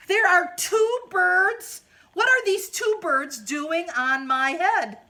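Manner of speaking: high, loud, slow disbelief; shocked elephant. Acted. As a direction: gasp it out in alarm. Elephant shocked by two birds.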